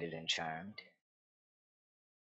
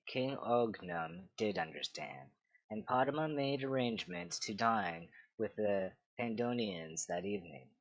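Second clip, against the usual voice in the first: ordinary delivery, nothing marked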